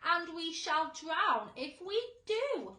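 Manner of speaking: loud, slow doom-laden cry; gloomy cry. A narrator's reading. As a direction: proclaim ruin. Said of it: "cried the earthworm".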